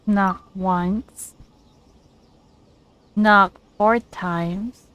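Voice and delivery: ordinary talk, nothing marked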